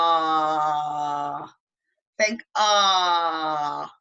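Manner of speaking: long drawn-out AH, sighing downward; falling-in-love sigh. Shown as a demonstration. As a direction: swoon on a long, low "ah". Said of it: "sound that you make whenever you're falling in love".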